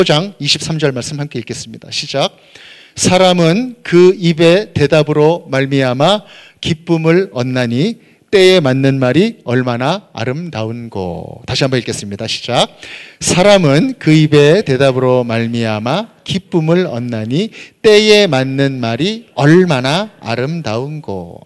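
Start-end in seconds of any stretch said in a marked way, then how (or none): 3.0-11.4 loud, very slow recitation; measured, projected unison-reading voice; congregational scripture reading. Acted. Leads scripture reading aloud. 13.2-21.4 loud, very slow recitation; measured, projected unison-reading voice; congregational scripture reading. Acted. Leads scripture reading aloud.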